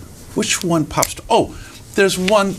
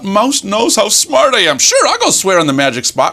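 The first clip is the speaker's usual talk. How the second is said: cocky fox; 7 semitones higher, 4 dB louder than usual. Acted. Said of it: "And fox thought".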